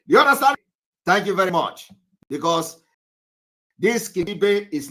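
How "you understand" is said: higher, louder demand; loud check-in. Real, not acted. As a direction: press the class for an answer. Teacher checks on the class.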